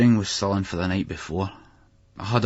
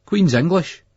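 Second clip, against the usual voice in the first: higher, louder, resentful; sullen repeat; 10 semitones higher, 7 dB louder, slower than usual. A narrator's reading. Boy made to repeat after the teacher, resentful. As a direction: parrot it back. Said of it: "Billy said".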